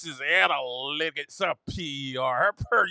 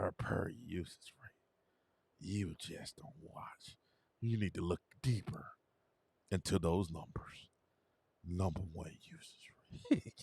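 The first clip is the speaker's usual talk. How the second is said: smug fan whisper; 11 semitones lower, 17 dB quieter than usual. Retold or imitated. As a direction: breathe the stats like gospel. Imitating fans' hushed lecturing.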